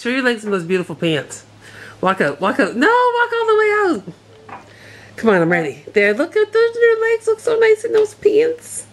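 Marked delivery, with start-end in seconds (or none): 2.8-8.8 put-on squeaky baby voice for the dog; high pet-talk voice cheering her on. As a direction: coo at the dog in a high sing-song.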